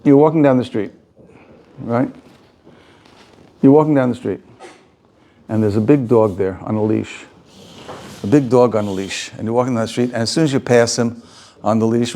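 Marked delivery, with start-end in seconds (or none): none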